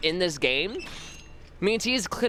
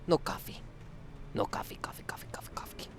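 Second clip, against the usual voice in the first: fading whispered chant; mock-tragic whisper, no coffee; whispered despair; 8 semitones lower, 11 dB quieter, faster than usual. Real, not acted. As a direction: whisper it, dying away.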